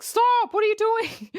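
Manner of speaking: high-pitched, exasperated mock shout; hammed-up cry of alarm; alarmed mock yell. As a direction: call out as if stopping a runaway kid. Acting Batman calling after runaway Robin.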